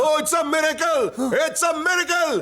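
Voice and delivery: high, thrilled exclamation; exclaiming in amazement. Acted. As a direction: proclaim it in awe and excitement. Doctor thrilled by the mantra.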